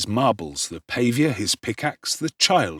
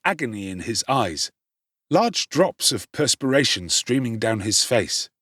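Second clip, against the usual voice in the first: usual speaking voice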